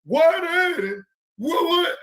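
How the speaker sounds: mock whiny viewer, shouted high; complaining viewer. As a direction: squawk it like an annoyed fan badgering you. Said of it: "Somebody gonna have something to say".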